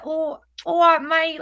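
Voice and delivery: a quick, hammed-up Essex "all right mate"; Essex accent. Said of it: "I don't even think I could do an Essex, Essex accent if I tried".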